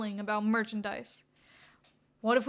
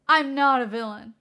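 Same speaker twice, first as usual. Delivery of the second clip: a louder flat denial; 10 dB louder than usual. Acted. Redestro denying to the detective, loud. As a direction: insist on it too hard.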